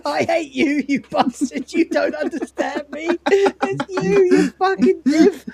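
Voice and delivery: put-on angsty teenager. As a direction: rage at yourself like a sulky teen. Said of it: "like a teenager".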